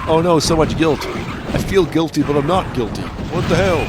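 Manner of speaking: mock guilty wail. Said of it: "It was like".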